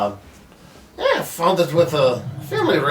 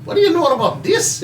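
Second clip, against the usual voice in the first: Haggis in character; 8 semitones higher than usual. Acted. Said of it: "Haggis is like".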